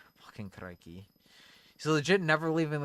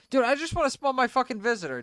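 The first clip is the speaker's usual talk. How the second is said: high, loud, fast complaint; frustrated outburst; 13 semitones higher, 6 dB louder, faster than usual. Real, not acted. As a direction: blurt it out in frustration. Frustrated with game.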